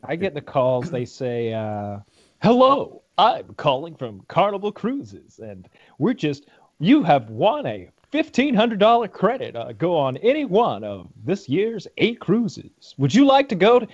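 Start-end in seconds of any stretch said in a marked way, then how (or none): 2.4-13.9 high, slow sing-song sales voice; chirpy cruise caller. Retold or imitated. Mocking the cruise scam caller, high and slow sing-song. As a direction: play the overly bright telemarketer pitching a prize.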